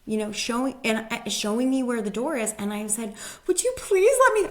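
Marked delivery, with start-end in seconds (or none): 3.5-4.5 much higher, louder, on the verge of tears; a hint of tearful pleading; mock crying plea. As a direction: beg through breaking tears. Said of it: "I start crying".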